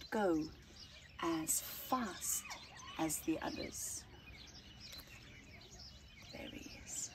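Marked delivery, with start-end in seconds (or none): 6.3-7.1 hushed aside pointing at the picture; whispered aside. Real, not acted. Reader to child, pointing out the tiny seed, whispered.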